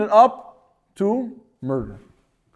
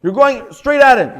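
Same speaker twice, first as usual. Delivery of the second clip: loud, higher scolding yell; yelling at someone; 6 semitones higher, 5 dB louder, slower than usual. Retold or imitated. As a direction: scream at him for doing wrong. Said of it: "I scream at the person".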